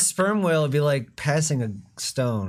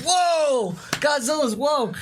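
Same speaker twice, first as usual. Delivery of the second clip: excited whoa; 11 semitones higher, slower than usual. Retold or imitated. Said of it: "It's like".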